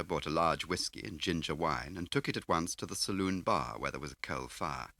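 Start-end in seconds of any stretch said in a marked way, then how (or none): none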